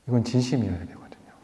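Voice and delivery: low, soft near-whisper; hushed emphasis. Real, not acted. Preacher telling the congregation it must be sincere, hushed. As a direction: drop to a hush on the key point. Hushed first take, then repeated.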